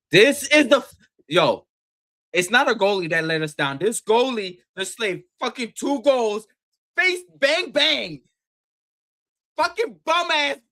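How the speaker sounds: high, loud angry rant; heated rant. Real, not acted. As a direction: rip into the goalie. Ranting about the goalie.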